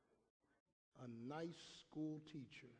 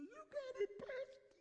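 1 crying mother. Retold or imitated. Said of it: "She was crying".

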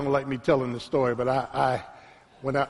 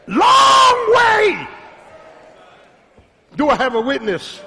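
high, loud, shouted preaching with long held peaks; full-force preaching; 8 semitones higher, 5 dB louder than usual. Acted. Preacher praising the Lord before his congregation, shouting high. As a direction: lift it to a shouting climax. Preacher climaxing and reading scripture.

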